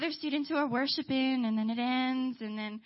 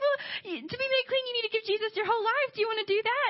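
high, giddy questioning; imitated eager invitation; 9 semitones higher than usual. Ray asking Gabe for his whole life, high and eager. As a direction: keep it high and excited.

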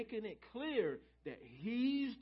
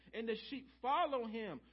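normal talking voice

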